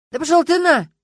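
a high, loud furious yell. A narrator's reading. Witness screaming at the detective, furious yell. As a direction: blast it back at him. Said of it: "заорал Варягин".

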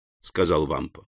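ordinary delivery

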